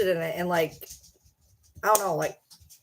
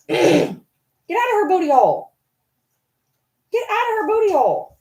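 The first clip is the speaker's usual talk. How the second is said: much higher, louder yell at the dog; shrill scold; 10 semitones higher, 4 dB louder than usual. Real, not acted. Owner yelling at one dog to leave the other alone. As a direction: shoo him off, loud and high.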